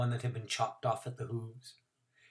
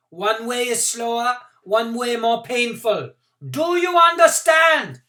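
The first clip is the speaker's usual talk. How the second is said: high, loud, slow megaphone threat; bullhorn announcement; 10 semitones higher, 12 dB louder, slower than usual. Acted. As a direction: blare the warning through a bullhorn. Man with a bullhorn.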